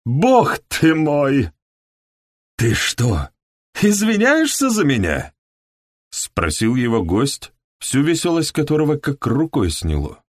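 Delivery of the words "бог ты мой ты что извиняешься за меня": high, loud, outraged; indignant outburst. A narrator's reading. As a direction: bellow the indignation. Guest outraged at being excused.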